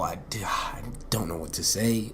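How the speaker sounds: breathy whispered aside, trailing off. Real, not acted. Speaker at a loss after his own point, whispering. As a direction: drop to a hushed whisper, at a loss for words. Stunned after "that's powerful.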